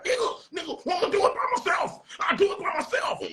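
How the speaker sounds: high angry shout imitating him; mock angry yell. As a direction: yell it like a mad friend. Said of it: "He was like, he got mad".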